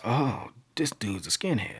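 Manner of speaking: hushed, slow dread of realizing; dawning realization. Retold or imitated. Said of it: "he realized".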